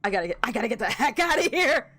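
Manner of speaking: fast, panicky rush to escape; scared. Real, not acted. Fleeing the fight.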